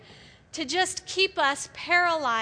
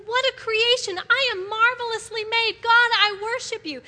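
bright joyful praise; 6 semitones higher, 4 dB louder than usual. Retold or imitated. David's praise voiced again.

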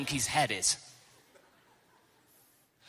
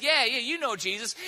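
higher, lightly voiced little girl; a hint of a child piping up; 7 semitones higher, 4 dB louder than usual. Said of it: "a little girl says".

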